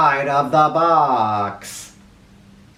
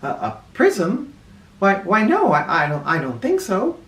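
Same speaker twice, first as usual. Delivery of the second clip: puzzled; 5 semitones higher than usual. A narrator's reading. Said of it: "Miss Reed looked puzzled".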